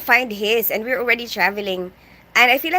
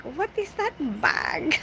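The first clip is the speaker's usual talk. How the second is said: drawn-out, higher mock disbelief; imitated appalled auntie; 4 semitones higher, 5 dB quieter, slower than usual. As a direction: stretch it out like an appalled aunt. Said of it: "my auntie in Japan, she's like".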